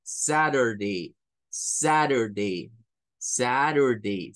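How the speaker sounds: Saturday slow, T flapped, SA-rer-day; standard Saturday demo. Shown as a demonstration. Teacher modelling standard Saturday slowly. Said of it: "Y luego el day".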